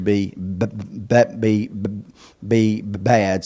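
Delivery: stuck on the b sound; stuttering. Real, not acted. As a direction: stutter on the word be. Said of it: "I don't stutter when I read".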